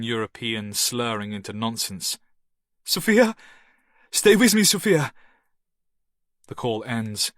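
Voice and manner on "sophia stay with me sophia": high, loud pleading; desperate man calling to her. A narrator's reading. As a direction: hold her on the line. Man calling to fading woman.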